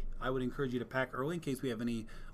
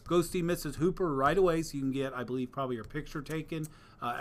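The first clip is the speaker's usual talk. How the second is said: ordinary talk, nothing marked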